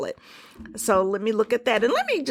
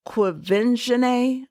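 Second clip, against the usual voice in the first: QUVENZHANÉ drawn out slowly and carefully, pitched up; 4 semitones higher, slower than usual. Shown as a demonstration. Pronouncing a little girl's name correctly for listeners, very slow. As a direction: say Quvenzhané syllable by syllable, unhurried and higher. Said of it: "I have to say it very slowly".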